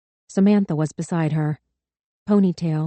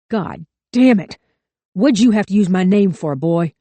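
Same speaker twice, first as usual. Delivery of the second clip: furious shout; 2 semitones higher, 6 dB louder than usual. A narrator's reading. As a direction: rage at the partner. Gunman raging at his partner.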